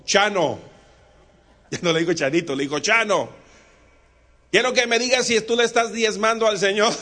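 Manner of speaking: loud pastor demanding the truth; stern demand. Acted. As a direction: press him hard for an honest answer. Wensmayer sternly demands an answer.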